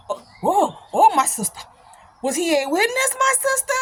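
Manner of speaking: imitated African accent rising to a high question; African-accented shock, climbing at the end; African brother's accent. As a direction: mimic an astonished African brother. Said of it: "this brother from Africa".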